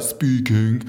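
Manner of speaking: drawn-out mock-posh answer; posh accent. Boss answers the sacked dentist's call, mock-posh accent. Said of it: "so posh".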